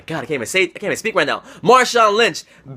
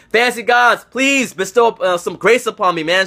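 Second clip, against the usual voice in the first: ordinary talk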